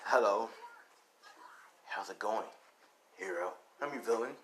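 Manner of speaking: low, soft voice of a masked character; hollow voice. Acted. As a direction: say it low and hollow, holding back volume. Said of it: "in his little hollow no-, voice".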